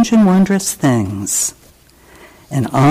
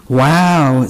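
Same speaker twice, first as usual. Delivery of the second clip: ordinary delivery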